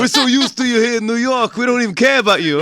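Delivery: high, pushy mock New Yorker; heckling New York accent. Host voices jaded New York crowd, hammed-up local accent. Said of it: "why I do that accent".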